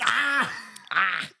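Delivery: loud, high mock screams mimicking a friend; hammed-up gleeful screaming. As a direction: yell it like a delighted viewer. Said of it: "He's like".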